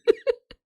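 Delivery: sharp high laugh; a quick amused cackle; high burst of laughter. Real, not acted. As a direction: let out a short high laugh. Laughs at own joke.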